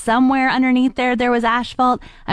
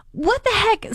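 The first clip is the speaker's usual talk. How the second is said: pitched way up, startled; shocked exclamation; 12 semitones higher than usual. Retold or imitated. Driver hit by kids on a bike, shocked yelp. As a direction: yelp it out as the bike hits your car. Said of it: "I was like".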